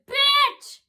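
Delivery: loud, high-pitched mimicked insult; imitated girl's yell. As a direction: shout it as if from a passing car. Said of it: "it sounded like she said".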